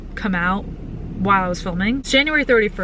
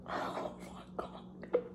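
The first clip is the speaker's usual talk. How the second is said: slow, barely-there whisper; awed whisper; 18 dB quieter, much slower than usual. Real, not acted. Reader overcome by the book's ending, whispering. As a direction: whisper it, overwhelmed. Overwhelmed reaction while reading.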